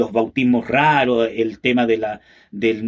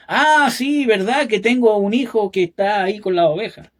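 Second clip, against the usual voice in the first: a lightly voiced father, higher, as if he just remembered; father's offhand reply; 8 semitones higher than usual. Father tells the prophet about his forgotten son, mock offhand. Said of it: "le dijeron".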